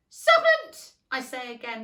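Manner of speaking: high loud cry, then settling; repeated cry. A narrator's reading. Pigeon repeating its accusation, a high cry. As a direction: shriek the word, then ease off. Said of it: "more quietly this time".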